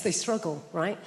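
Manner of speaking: ordinary talk, nothing marked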